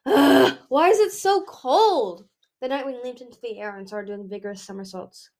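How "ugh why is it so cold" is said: loud, high, drawn-out groaning complaint; hammed-up frozen misery; exasperated outburst. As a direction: wail about the cold, stretching it out. Freezing Nightwing bursts out complaining.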